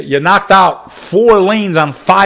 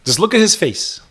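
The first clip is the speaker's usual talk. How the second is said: ordinary talk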